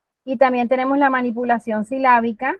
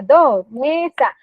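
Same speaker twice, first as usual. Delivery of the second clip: Dos and mesa said slowly and a bit louder, syllables apart; slow counted answer; 2 semitones higher, 5 dB louder, much slower than usual. Shown as a demonstration. Therapist modelling the child's answer: slow, clear count. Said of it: "cuántos golpes silábicos tiene mesa".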